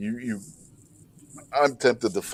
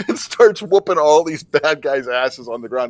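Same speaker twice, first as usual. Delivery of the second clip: laughing; 3 semitones higher, 5 dB louder than usual. Real, not acted. Laughing while telling the scene.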